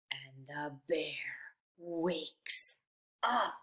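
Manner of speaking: slow hushed whisper; suspense whisper. A narrator's reading. Teacher whispering to children as the bear wakes.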